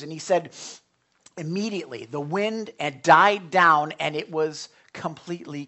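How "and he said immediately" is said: ordinary talk, nothing marked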